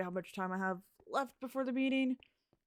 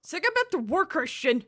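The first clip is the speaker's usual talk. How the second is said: high, loud order; loud, high-pitched command; bossy yell; 7 semitones higher, 10 dB louder than usual. Acted. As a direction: shout the worker back to the job. Boss ordering a worker in game.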